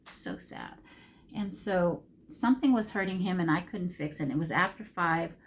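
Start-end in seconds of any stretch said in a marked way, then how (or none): 0.0-0.8 breathy near-whisper, low and soft; hushed sadness. Real, not acted. Owner thinks of sick pets, whispered sadness. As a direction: let it drop into a whisper. Sad about sick pet.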